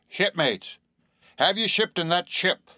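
high-pitched hail to shipmates; insistent sailor stranger's question; mysterious old sailor. A narrator's reading. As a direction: pitch it up like an odd old seaman.

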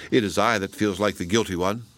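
plain reading voice

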